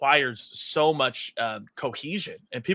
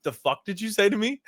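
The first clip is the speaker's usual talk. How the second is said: mock menacing comeback; 7 semitones higher than usual. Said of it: "he said".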